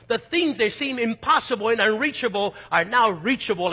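fervent sermon build; preaching. Real, not acted. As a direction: preach it out with growing force. Preaching builds to full force.

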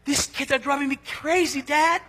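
put-on exasperated grown child. Adult child complaining to father.

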